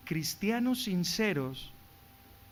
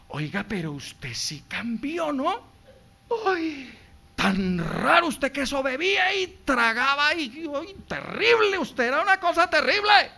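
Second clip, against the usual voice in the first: lightly voiced amazed neighbour; gossiping acquaintance; 4 semitones higher, 3 dB louder than usual. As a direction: marvel at the change, half teasing. Voicing surprised acquaintance. (vecina asombrada, apenas actuada)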